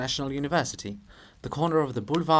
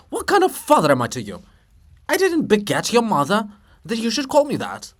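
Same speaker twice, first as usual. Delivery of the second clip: indignant scolding; 8 semitones higher, 7 dB louder than usual. Acted. Shevchenko scolds.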